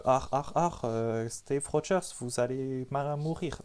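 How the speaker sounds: hammed-up German accent with harsh r's; mock German accent. Imitating the film's doctor, mock German accent. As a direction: put on a rough German accent, slowing at the end. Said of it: "il parle avec un accent allemand, du style".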